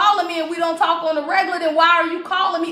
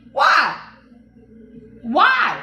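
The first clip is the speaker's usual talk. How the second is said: shouted why. Real, not acted. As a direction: demand an answer with a shout. Challenging the kings.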